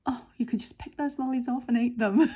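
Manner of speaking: imitating a guest while laughing; laughing through quote. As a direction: giggle out the line.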